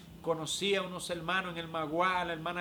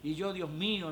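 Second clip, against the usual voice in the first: ordinary talk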